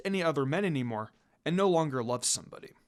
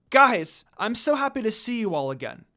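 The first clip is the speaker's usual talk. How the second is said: happy greeting; 4 semitones higher, 6 dB louder than usual. A narrator's reading. Happy reunion with friends.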